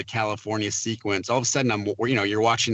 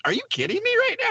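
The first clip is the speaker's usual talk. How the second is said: much higher, fast, incredulous; disbelieving surprise; faster than usual. Retold or imitated. Retelling his reaction to real baptisms during the shoot, much higher fast disbelief. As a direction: blurt it out in sheer disbelief.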